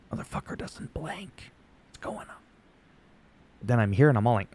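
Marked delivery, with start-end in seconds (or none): 0.1-2.4 hushed aside, all breath and no voice; mock whisper. Viewer puzzled after meeting the YouTuber, mock whispering. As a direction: whisper it as a baffled onlooker.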